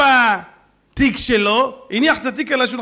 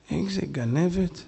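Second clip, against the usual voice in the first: muttered accusation; 6 semitones lower, 8 dB quieter, slower than usual. Retold or imitated. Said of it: "אמר לו".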